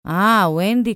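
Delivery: loud, accusing exclamation; angry call. A narrator's reading. Father learning who told the stories, angry call. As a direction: say it with cross realization. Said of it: "קרא אבא בכעס".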